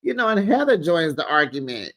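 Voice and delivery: normal talking voice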